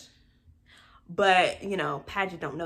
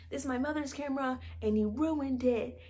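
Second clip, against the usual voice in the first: higher, fast mock complaint; mock upset rant; 5 semitones higher, 5 dB quieter than usual. Vlogger acts Cameron storming out, higher and quick mock anger. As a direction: rattle it off in put-on upset. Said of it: "he's like".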